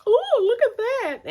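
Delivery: high, loud, drawn-out admiration; stretched-out mock amazement; admiring gush. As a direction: imitate impressed visitors cooing. Acting out visitors admiring the coat.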